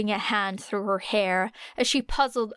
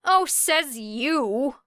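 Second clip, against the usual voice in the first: slow, sneering retort; slow mocking jab; snapped; 5 semitones higher, much slower than usual. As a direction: throw it back at him mockingly. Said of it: "snapped Era".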